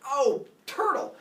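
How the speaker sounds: high, loud, drawn-out cartoon shock; hammed-up startled rabbit; acted alarm. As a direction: cry out in slow mock alarm. Startled rabbit sees the turtle.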